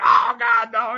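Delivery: light imitation of a yelp; mock scream. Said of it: "the guy be screaming like crazy".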